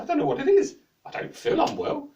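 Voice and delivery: high, loud puzzled voice; bewildered jester. A narrator's reading. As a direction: protest in confusion.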